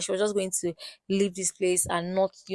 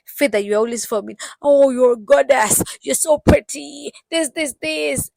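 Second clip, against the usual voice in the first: mock flattery; 6 semitones higher, 6 dB louder than usual. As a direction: lay the fake sweetness on thick. Mocking Ramel flattering Faith.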